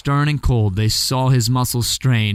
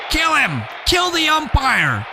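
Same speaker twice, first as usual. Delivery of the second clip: much higher, slowed fan yell; angry crowd shout; 12 semitones higher than usual. Acted. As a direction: bellow from the stands at the umpire. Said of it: "shouted someone on the stand".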